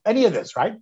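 usual speaking voice

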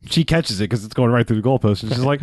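usual speaking voice

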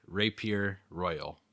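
usual speaking voice

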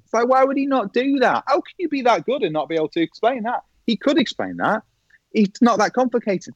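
high-pitched, baffled protest; retold incredulity. Expert thinking about golfer's simple explanation, mock incredulous. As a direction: act out exasperated disbelief.

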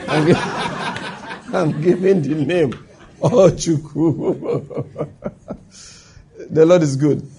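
slow words through laughter; real laughter. Real, not acted. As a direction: laugh helplessly while drawing out the words. Laughing while telling name story.

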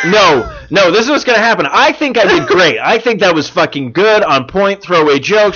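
high, animated mock protest; hammed-up self-defence; mock indignant. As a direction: play up being proud of your joke. Mock defence of his own joke.

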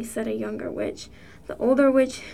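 ordinary delivery

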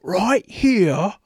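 high-pitched mock Aussie nature show host; slow, exaggerated Australian presenter voice; Australian accent. Shown as a demonstration. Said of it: "your Australian accent".

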